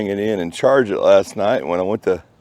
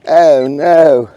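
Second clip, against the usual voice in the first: dismayed oh no; 2 semitones higher, 9 dB louder than usual. Real, not acted. Spots the dreaded junk signal.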